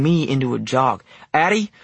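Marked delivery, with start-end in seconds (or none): 1.3-1.7 high, urgent holler; raised-pitch, desperate name call. A narrator's reading. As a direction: call after someone hurrying away. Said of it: "I hollered".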